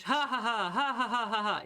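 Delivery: loud, high laugh at herself; rueful burst of laughing; hearty laughter. Real, not acted. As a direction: laugh it off, caught out. Streamer laughs at own backlog.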